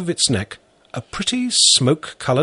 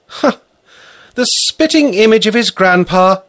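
said with a smile; 11 semitones higher, 7 dB louder than usual. Acted. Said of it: "said with a smile".

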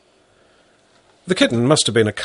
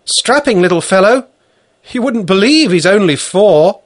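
proud woman; 10 semitones higher, 7 dB louder than usual. Acted. Valentina proud of boy.

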